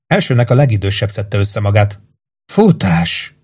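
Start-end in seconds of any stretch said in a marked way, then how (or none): 2.5-3.4 panicked whisper. Acted. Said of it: "suttogta pániktól érdes hangon".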